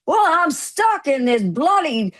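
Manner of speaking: high, slow, wailing complaint; exasperated imitation. Man stuck in the tub complains, exasperated. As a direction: drag out each word in mock exasperation.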